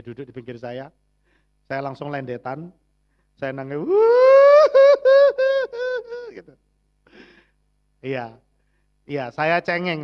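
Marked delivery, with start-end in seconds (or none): 3.8-6.3 a loud, high burst of laughter. Real, not acted. Pastor laughing loudly at his own tears before the congregation, loud high laugh. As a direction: break into a big laugh at yourself. Big laugh after saying he cried.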